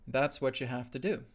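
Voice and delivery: ordinary delivery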